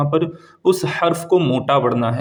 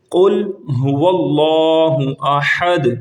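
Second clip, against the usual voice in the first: very slow, drawn-out tajweed chant; slow devotional recitation; Quranic recitation; much slower than usual. Shown as a demonstration. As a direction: recite each word stretched and melodic. Recites while teaching memorizing.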